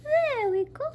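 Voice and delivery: high, loud triumphant cry; triumphant high shout. Real, not acted. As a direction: celebrate the yeast finally dropping. Yeast finally comes out.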